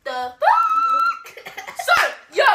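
laughing. Real, not acted. Laughing at brother's intro.

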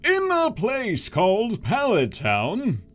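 high, slow storybook narrator; lilting narrator opening. A narrator's reading. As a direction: announce it like a cartoon narrator. Narrator voice reading manga.

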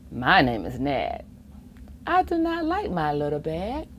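ordinary delivery, nothing marked

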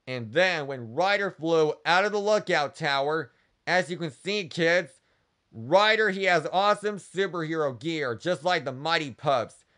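ordinary delivery

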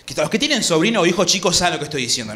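usual speaking voice

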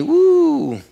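high, drawn-out "woo"; sudden high whoop of mock interest; mock perked-up woo. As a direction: let out a rising, teasing woo. Mimics listeners perking up at money.

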